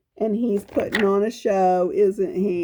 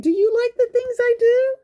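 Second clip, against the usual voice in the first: higher, quick eager question; eager leopard asking; 14 semitones higher, 3 dB louder, faster than usual. Acted. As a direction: ask the kids hopefully, bouncing up. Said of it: "And he's saying".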